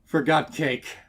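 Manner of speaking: panting words between gasps, loud and high; breathless stallion blurting between heaves; out of breath. A narrator's reading. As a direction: gasp it out after a hard gallop. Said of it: "chest heaving with every ragged breath".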